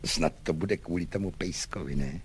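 low, soft confiding aside; confidential. A narrator's reading. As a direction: drop your voice as if sharing a secret. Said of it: "A důvěrně dodal".

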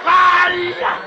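high, very loud yell; shout. Pretend. Rescuer charges in at the injured man, loud shout. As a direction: scream a name at the top of your lungs. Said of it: "Jste se zbláznil".

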